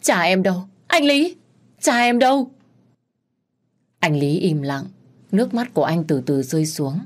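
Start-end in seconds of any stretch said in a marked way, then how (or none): 0.0-2.5 high, loud frenzied demand; a daughter frantically asking where her father is; frantic questioning. A narrator's reading. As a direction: shake him for an answer. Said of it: "tôi điên cuồng hỏi".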